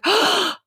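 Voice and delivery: sharp, high intake of breath; retold gasp of shock; acted shocked gasp. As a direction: gasp as if appalled. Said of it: "I was like".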